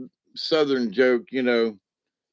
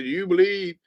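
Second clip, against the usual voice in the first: preacher asking; 7 semitones higher than usual. Acted. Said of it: "the preacher looks at him and says".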